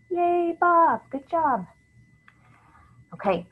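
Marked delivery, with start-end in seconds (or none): 0.1-1.7 high, loud mock congratulations; playful cheer. Cheering for Bob in example sentence.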